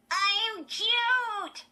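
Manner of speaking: very high, loud puppet voice; squeaky dummy voice. A narrator's reading. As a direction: boast like a ventriloquist's dummy. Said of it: "she made him say".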